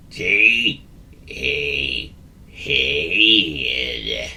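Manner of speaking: a gloomy copy of the giggle; miserable repeat. A narrator's reading. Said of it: "repeated Gary Grizzle miserably".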